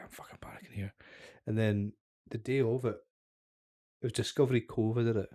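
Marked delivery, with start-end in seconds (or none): 0.0-0.9 panicked whisper; whispered inner panic. Retold or imitated. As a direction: hiss it under your breath. Retelling his panic with the ring.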